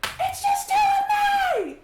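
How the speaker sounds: high, loud, stretched-out yell; slow, high cheering shout; cheering scream. Acted. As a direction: holler it like a wild supporter. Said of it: "She's so funny".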